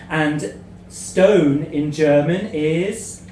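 usual speaking voice

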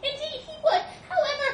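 high, loud mouse squeak; flustered little mouse. Acted. Mouse trying to object, high and flustered. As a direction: squeak out a reluctant admission.

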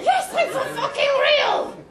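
high, loud indignant yell; indignant shout. Acted. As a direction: shout it back, offended. Insists loudly back.

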